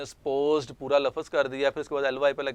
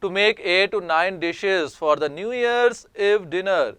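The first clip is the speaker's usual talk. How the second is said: slow, deliberate dictation reading; careful classroom reading voice; slow read-aloud; 5 semitones higher, 4 dB louder, much slower than usual. Shown as a demonstration. Teacher reads example text aloud.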